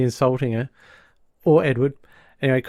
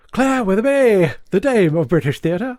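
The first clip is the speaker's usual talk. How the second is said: grand British dame; 7 semitones higher, 4 dB louder than usual. Retold or imitated. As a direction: announce yourself like a grand stage dame. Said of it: "the British customer calls themselves".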